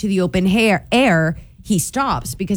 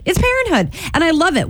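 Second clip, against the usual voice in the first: gushing; 9 semitones higher, faster than usual. Real, not acted. Heartfelt wrap-up.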